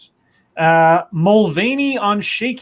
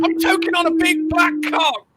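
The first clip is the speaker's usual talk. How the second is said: high mock voice; 12 semitones higher than usual. Crude high-voiced interjection.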